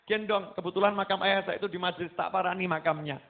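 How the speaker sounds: ordinary talk, nothing marked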